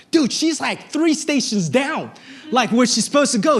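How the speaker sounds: high, incredulous retold reaction; alarmed disbelief. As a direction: act out spotting a mistake in alarm. Retells alarm at tracker showing wrong station.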